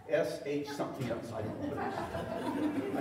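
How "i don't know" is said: laughing. Real, not acted. Laughs at own joke.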